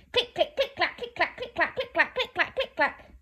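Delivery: high, rhythmic click-clack; clattering skeleton bones. Acted. Skeletons forcing monsters to flee, high rattling click-clack. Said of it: "Go the skeletons".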